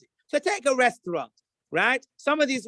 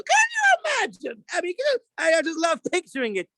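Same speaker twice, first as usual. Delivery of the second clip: excited disbelief; 8 semitones higher than usual. Real, not acted. The rabbi picturing Gordon Ramsay facing a mashgiach, excitedly shouting. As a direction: shout it out, tickled by the image.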